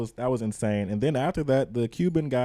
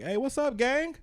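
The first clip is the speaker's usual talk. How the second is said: very high-pitched caricature of a clerk; imitated clerk greeting. Imitating the clerk opening the window, squeaky mock greeting. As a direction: pipe up with a high, chirped hello.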